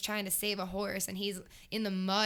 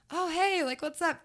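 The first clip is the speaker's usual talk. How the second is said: much higher, louder, cheerful casual hello; breezy Jeff greeting; 9 semitones higher, 7 dB louder than usual. Retold or imitated. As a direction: greet a fan like it's nothing.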